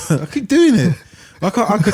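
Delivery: giggling through the words; laughing. Real, not acted. Laughs at own flubbed words.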